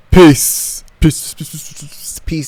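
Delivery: high, loud first peace fading to a whisper; sing-song goodbye trailing off; playful sign-off. Real, not acted. Signing off to viewers, loud then fading to a whisper.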